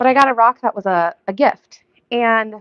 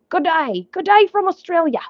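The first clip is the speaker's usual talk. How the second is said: higher-pitched mock Aussie greeting; cheery hammed-up Australian accent; 8 semitones higher than usual. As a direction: put on a playful Australian accent. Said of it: "whenever I start doing accents".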